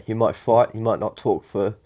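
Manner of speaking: ordinary talk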